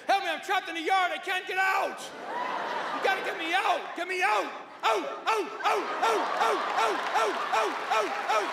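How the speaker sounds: high, yelped pleas like barking; desperate dog. Acted. Dog trapped in the yard, desperate yelping. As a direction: bark the words in frantic high bursts. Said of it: "What's he out there trying to say?".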